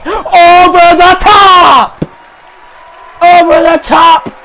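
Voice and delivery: high, loud, drawn-out yell; commentator's roaring call; excited shout. Real, not acted. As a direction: belt it out as he flies over. Wrestler dives over the ropes.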